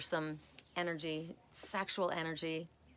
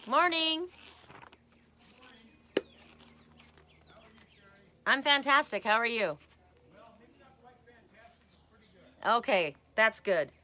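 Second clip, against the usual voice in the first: loud, high, cheery call across the yard; calling out greeting; 7 semitones higher, 10 dB louder than usual. Real, not acted. Greeting the neighbor and her wiener dog, loud cheery call. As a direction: hail the neighbor from a distance.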